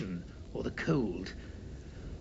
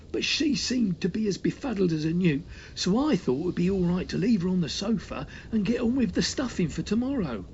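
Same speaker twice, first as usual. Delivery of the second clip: high sheepish servant voice; shamefaced servant; 7 semitones higher than usual. A narrator's reading. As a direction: protest like an embarrassed butler. Servant explains, put-on voice.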